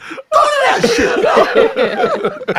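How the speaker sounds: stretched, high laugh-filled line; real laughing outburst. Real, not acted. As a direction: squeal it through laughter. Laughing recap.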